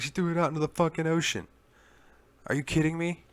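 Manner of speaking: hammed-up outrage, disbelieving; angry rant. Therese rages at the player about the locket, imitated fury.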